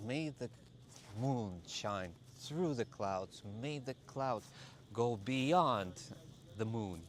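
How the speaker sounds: slow, intoned command to the sky; solemn incantation. Retold or imitated. Telling the clouds to clear for his girlfriend, solemn incantation. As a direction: call on the clouds like a spell. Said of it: "I fully focused with sincerity".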